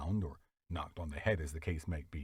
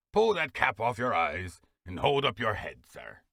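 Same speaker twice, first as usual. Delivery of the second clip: louder commanding beadle voice; stern order; 2 semitones higher, 7 dB louder than usual. Acted. Beadle scolding the boy, stern command. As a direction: bark the order at the child.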